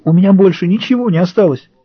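high, pleading shout; desperate cry. A narrator's reading. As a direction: cry out at the end of her rope. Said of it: "сорвалась на крик Рэйчел".

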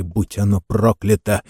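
usual speaking voice

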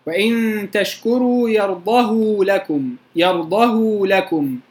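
slow chanted verse, long u on يرضه; ishba' recitation. Shown as a demonstration. Teacher recites the verse to the class, long u on يرضه. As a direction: recite slowly, stretching the u after the ه of يرضه. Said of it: "نقرأها إزاي".

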